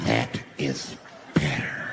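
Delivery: low, halting near-whisper; hushed mock menace; menacing calm. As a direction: say it slowly and very low. Same words again, crowd quiet.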